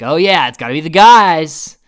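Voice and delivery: loud, exaggerated group reaction; mock knowing cheer. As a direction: call it out loudly, hamming up the obviousness. Men joke about expected pick order.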